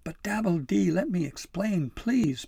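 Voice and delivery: high, loud urgent plea; pleading. A narrator's reading. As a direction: beg to be allowed to explain. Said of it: "Pim pleaded".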